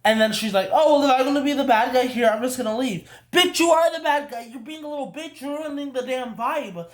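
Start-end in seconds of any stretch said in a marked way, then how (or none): 0.7-3.1 higher, louder, quick mock of a sulky woman; hammed-up wounded tone, rushing through it; mocking her playing the victim, pitched up and fast; mock victim whine. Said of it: "playing victim". 3.3-6.0 fed up, let it burst out; ranting at the screen, pitched way up; exasperated outburst. Real, not acted. Ranting at a film character.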